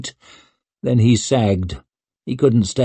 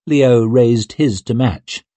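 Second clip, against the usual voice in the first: ordinary delivery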